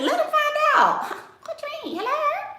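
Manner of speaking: high mocking disbelief; mock incredulous. Scoffing at scared women, high mock disbelief. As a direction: scoff at the question in a squeaky voice. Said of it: "mimics reactions".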